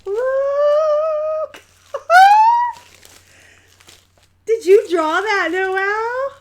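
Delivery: high, loud squeal with a laugh; laughing, thrilled shriek; delighted squeal. Real, not acted. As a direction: gush over a cute drawing. Squealing at friend's drawing.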